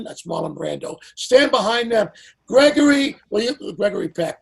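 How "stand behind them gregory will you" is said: calling out names, higher and louder. Retold or imitated. [name] lining up stars on the dais, a loud commanding call. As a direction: summon the stars like the host in charge. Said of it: "So he says".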